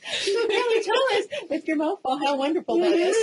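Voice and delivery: high, laughing question. Real, not acted. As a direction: tease through laughter. Laughing at the co-host eating.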